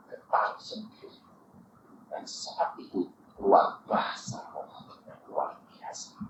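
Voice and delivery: hushed, breathy whisper in awe; whispered wonder at tongues. Real, not acted. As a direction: drop to an awed whisper.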